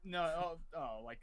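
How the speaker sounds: ordinary talk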